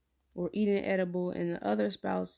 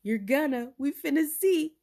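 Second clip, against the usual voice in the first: teasing friend; 7 semitones higher, 6 dB louder than usual. Retold or imitated. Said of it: "she said".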